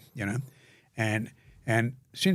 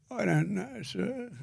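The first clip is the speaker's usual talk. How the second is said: put-on higher, scared little voice of a beaten kid, fading softer at the end; imitating a crying boy; 11 semitones higher, 6 dB quieter, slower than usual. Beaten boy answering the guards, imitated scared high voice. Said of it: "and I heard him crying".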